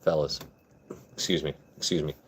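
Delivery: low, measured bid for attention; getting serious. Pretend. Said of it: "Let me get serious".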